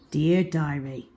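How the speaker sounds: ordinary delivery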